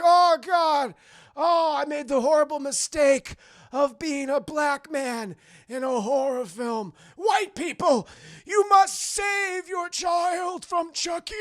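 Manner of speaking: loud, high, labouring dying voice; hammed-up dying plea. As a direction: plead theatrically with your last breath. Dying John's last plea.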